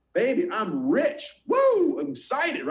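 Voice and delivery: hyped-up cheer, pitch jumping high; playful whoop of joy; mock excited. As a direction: gloat like a rich man. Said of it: "You're like".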